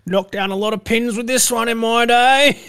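high, loud put-on bragging; mock boastful bowler. Co-host playing along about bowling, mock boasting. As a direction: crow like a hammed-up old champion.

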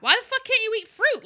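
retold outburst about banned fruit; exasperated outburst. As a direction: fling the question out in outrage. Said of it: "And I'm like".

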